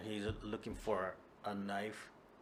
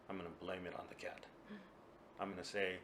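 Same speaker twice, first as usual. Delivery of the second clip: quiet; 2 semitones lower, 10 dB quieter, faster than usual. Acted. Kuma plotting quietly.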